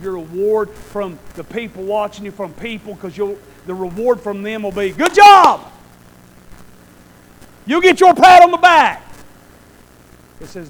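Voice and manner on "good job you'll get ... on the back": loud high cheering praise; mock cheer. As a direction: shout it like the crowd praising. Mocking praise from people.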